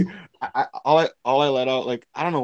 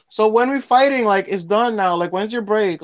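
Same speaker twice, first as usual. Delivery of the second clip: higher, insistent mock girl voice; imitated pushy girl; 7 semitones higher, 4 dB louder than usual. Retelling the girl asking about his break, imitated pestering. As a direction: mimic her pestering, pitched up and pressing.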